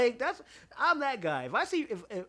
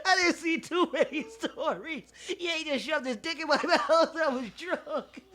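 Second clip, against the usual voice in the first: laughing protest; 8 semitones higher, 4 dB louder, slower than usual. Retold or imitated. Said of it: "I was like".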